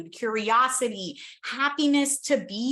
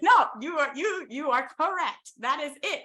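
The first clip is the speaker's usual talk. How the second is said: hammed-up, higher teasing insistence on you are correct; mock insistence; 4 semitones higher than usual. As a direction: insist playfully to tease your brother. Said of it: "That's not what I was saying".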